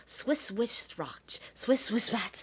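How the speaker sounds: soft unvoiced whisper. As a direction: whisper the tongue twister. Tongue twister whispered practice.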